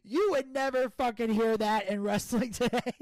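ordinary delivery

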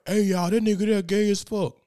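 loud, high jeering announcement to the cafeteria; big classmate taunting him in the cafeteria; mocking bully. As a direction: call it out to embarrass him. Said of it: "and he was like".

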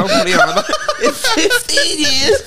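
high, laughing disbelief; incredulous. Real, not acted. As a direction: squeak it out in disbelief. Incredulous at the question.

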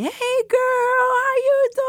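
high, slow cheerful greeting; man's greeting. Retold or imitated. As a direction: greet her warmly, high and drawn out. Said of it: "[name] recognized me".

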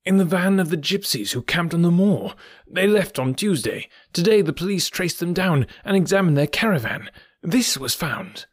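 put-on a fretful old doctor who has finally found hope; Doctor Huxtable showing the cap, high excited relief; overjoyed headmaster. A narrator's reading.

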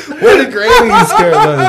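high laughing chatter. Real, not acted. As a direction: talk through giggles. Laughing through the bit.

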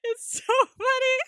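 high, loud squeal of amusement; delighted amusement. Real, not acted. Podcaster loving her favorite scene, delighted squeal. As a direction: exclaim it high, overcome with laughter. Cracking up at her own retelling.

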